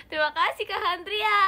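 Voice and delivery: loud, high thank-you yell; teriak-teriak. Real, not acted. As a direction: shout out the gift-giver's name. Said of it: "kita teriak-teriak". (teriakan terima kasih yang nyaring)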